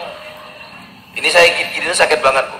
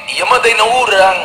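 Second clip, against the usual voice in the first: Sundanese reply, pitched up; 7 semitones higher than usual. Retold or imitated.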